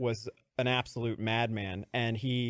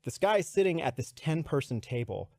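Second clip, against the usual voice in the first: normal talking voice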